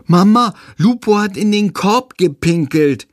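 put-on boy telling on the puppy; child calling mum. A narrator's reading. Child calls to mother.

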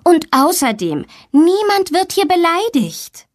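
high-pitched teacherly telling-off; admonishing. A narrator's reading. Teacher forbids Tommy to insult classmates, admonishing. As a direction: rise sharply in pitch as you lay down the rule. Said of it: "ermahnt ihn die Lehrerin".

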